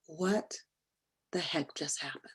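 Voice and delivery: hushed, breathless inner thought; shocked whisper of disbelief; stunned whisper. Retold or imitated. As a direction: mutter it in disbelief to yourself.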